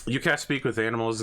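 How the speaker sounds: ordinary talk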